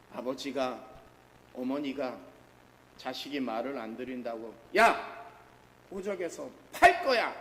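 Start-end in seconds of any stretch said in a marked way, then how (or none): 4.8-7.3 부모 흉내, 버럭 고함; 말 안 듣는 자식에게 호통치듯; 호통치는 부모. Retold or imitated. 부모가 자식에게 호통. (말 안 듣는 자식에게 버럭 소리치는 부모)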